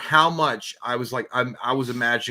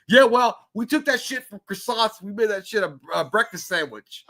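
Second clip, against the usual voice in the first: high-pitched, hammed-up British retort; botched British accent, bragging; mock British accent; 7 semitones higher than usual. [name] boasting to a New York stranger, mock British accent. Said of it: "That's not even British".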